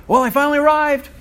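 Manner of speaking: high, loud sing-song announcement; mock Facebook post. Teacher parodying a vague Facebook post, high and loud. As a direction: ham up a smug status update. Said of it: "like a Facebook posting".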